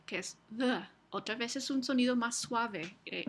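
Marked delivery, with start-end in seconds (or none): 0.6-0.9 the as a soft voiced th; the, tongue between the teeth, voiced; a gentle th sound for the; soft fricative d. Shown as a demonstration. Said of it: "vamos a usar la fricativa D".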